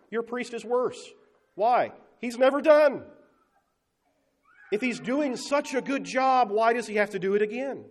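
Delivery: loud, high, driving preacher's cry; forceful preaching. Acted. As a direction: hammer the point home to the crowd.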